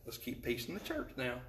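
hushed, low mock caution; joking aside. Real, not acted. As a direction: drop right down for a dry word to the congregation. Joking aside after mimicking wives.

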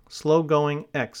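"slow-going" stretched out clearly for writing down; slow dictation. Shown as a demonstration. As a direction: read out slowly and deliberately for dictation. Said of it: "slow-going has a hyphen".

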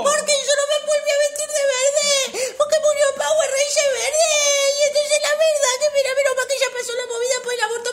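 high, loud, gushing wail; hammed-up weeping. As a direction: ramble on in theatrical grief. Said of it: "me da ganas de llorar".

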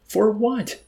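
high, loud frantic question. A narrator's reading. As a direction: demand it in rising panic. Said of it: "persisted the chaplain more frantically".

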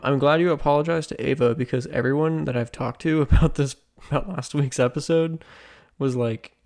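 usual speaking voice

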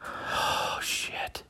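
breathless whispered curse, barely audible; panicked whisper. Retold or imitated. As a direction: hiss it under your breath, caught red-handed. Panicked inner reaction, caught.

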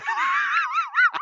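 sudden burst of laughter way up high; shrieking laugh. Real, not acted. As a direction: let out a squealing cackle. Laughing at "fuck that guy.

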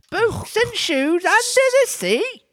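very high, slow squeaky question; incredulous beast; Griffin. A narrator's reading. Griffin exclaims.

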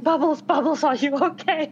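loud, worried call to a pet; acted alarm. Player calls to Bubbles after the crash, worried and loud. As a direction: urgently check on your baby.

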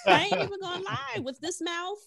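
laughing. Real, not acted. Laughing confession to co-hosts.

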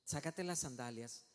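low, soft, solemn voice; God's hushed command. Acted. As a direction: quietly command with gravity. God voiced in closing command.

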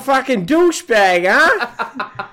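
high, loud hammed-up Boston insult; mock Boston tough guy. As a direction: spit it like a Boston meathead. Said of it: "We'll be like".

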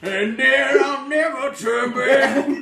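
slow high hammy declaration; dramatic. Pretend. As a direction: declare it like an anime hero.

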